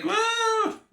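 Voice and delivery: high-pitched "woo"; playful toddler whoop. Retold or imitated. Toddler flashing dad's worker, mock gleeful whoop. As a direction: imitate a gleeful little kid's whoop.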